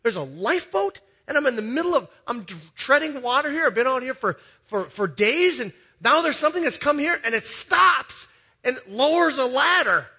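high, loud astonished rush; amazed disbelief. Retold or imitated. Drowning listener seeing the lifeboat, loud excited disbelief. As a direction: sell the shock of a drowning man spotting rescue. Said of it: "And so you go".

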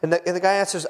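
ordinary talk, nothing marked

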